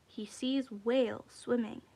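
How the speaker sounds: usual speaking voice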